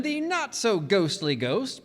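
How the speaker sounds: ordinary delivery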